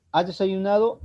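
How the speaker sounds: "¿Has desayunado?" high and rising; tono ascendente. Shown as a demonstration. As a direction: lift "¿Has desayunado?" into a clear yes-no question. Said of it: "es un tono ascendente". ("¿Has desayunado?" con tono alto que sube)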